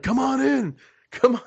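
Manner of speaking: high-pitched retold holler; urging call. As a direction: wave them in with a high, urgent call. Retold call urging hesitant fans in.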